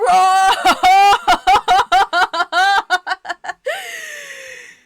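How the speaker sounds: loud helpless laughter; 1 laughing fit. Real, not acted. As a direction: crack up and lose it completely. Laughing fit over the line.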